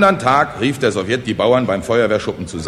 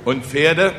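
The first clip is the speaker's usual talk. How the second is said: hesitant, slightly raised question; anxious; 5 semitones higher than usual. Acted. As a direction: ask with a touch of dread. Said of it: "fragten ängstlich die Bauern". (ängstliche Frage)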